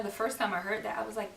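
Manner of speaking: normal talking voice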